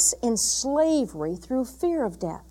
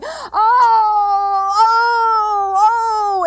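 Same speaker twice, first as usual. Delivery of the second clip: high, long-drawn wail of grief; loud mourning wail; 12 semitones higher, 10 dB louder, much slower than usual. Retold or imitated. Preacher imitates Mary at the tomb, wailing very loudly. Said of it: "that weeping is a wailing".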